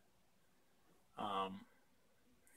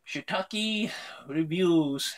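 slow announcer intro; 7 semitones higher, 6 dB louder, much slower than usual. Acted. Announcing channel segment name.

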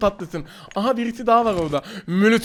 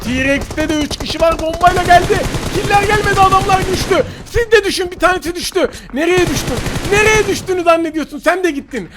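fast heated callouts, pitched up and loud; excited; 8 semitones higher, 5 dB louder than usual. Real, not acted. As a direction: shout the fight as it happens. (heyecanlı, yüksek sesle)

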